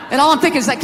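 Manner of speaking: ordinary delivery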